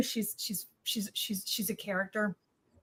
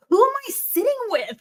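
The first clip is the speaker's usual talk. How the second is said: higher, louder astonished question; amazed; 10 semitones higher, 4 dB louder, faster than usual. Retold or imitated. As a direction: marvel at who is beside you. Amazed at [name] news.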